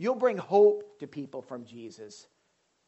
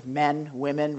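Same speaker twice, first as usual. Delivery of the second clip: ordinary talk, nothing marked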